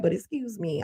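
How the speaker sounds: ordinary talk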